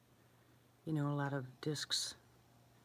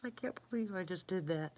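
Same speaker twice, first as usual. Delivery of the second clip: ordinary talk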